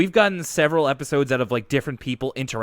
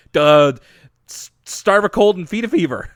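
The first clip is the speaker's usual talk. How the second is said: dopey loud dad-ism; 5 semitones higher, 9 dB louder than usual. Retold or imitated. [name] reading dad-isms.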